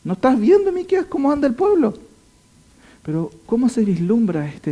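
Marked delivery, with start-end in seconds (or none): 0.0-1.9 much higher, pleading disbelief; incredulous protest. Retold or imitated. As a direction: cry out like a shocked contemporary. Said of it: "muchos dijeron".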